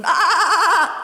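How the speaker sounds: high, loud bleating baa; sheep bleat. Acted. Frightened sheep bleating at the boy. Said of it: "they bleated".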